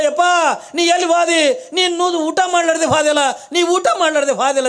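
high, loud grieving call. Acted. Kalavve calls for the vanished holy man, grieving. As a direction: call out for the lost guest in grief.

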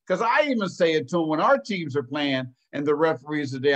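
usual speaking voice

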